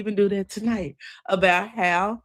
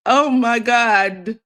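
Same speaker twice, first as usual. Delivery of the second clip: slow, louder gasped oh my God; retold shock; 5 semitones higher, 6 dB louder, much slower than usual. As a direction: recall the jolt of seeing the photo. Sees cousin's photo, shocked.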